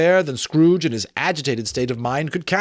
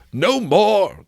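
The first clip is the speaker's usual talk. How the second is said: louder, higher cry of no more; anguished cry; 9 semitones higher, 6 dB louder than usual. A narrator's reading. Scrooge crying out to the Ghost, anguished. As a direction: cry out in torment. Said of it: "cried Scrooge".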